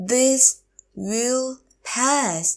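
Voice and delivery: This, will, pass, drawn out with gaps; slow teacher model of "This will pass"; word by word. Shown as a demonstration. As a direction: stretch and separate each word of "This will pass". Teacher repeats phrase word by word.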